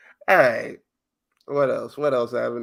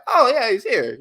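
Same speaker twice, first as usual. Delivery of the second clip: higher, louder mock surprise; 9 semitones higher, 6 dB louder than usual. Retelling the crowd noticing Jimmy, with mock surprise. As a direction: act like you just spotted him. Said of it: "They're like".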